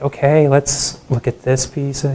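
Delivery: mock stalling, words dragged out; slowed way down. Nervous student stalling through the last slides, slowed way down. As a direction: imitate a presenter slowly killing time. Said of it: "I just slowed way down".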